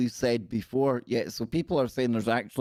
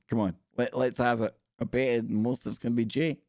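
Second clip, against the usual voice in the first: ordinary talk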